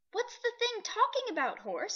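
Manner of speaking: high, puzzled creature voice; curious beasts; animal chorus. A narrator's reading. Several animals asking the horse, high and puzzled.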